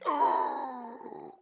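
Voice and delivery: long high gasp; mock shocked gasp. Player seeing exhaustion on a sheet, mock shocked gasp. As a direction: suck in a big theatrical gasp.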